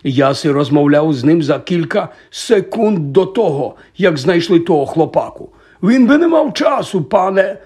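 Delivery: excited, insistent protest; agitated Hagrid. A narrator's reading. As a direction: plead the case at full tilt. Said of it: "збуджено розмахуючи півнем".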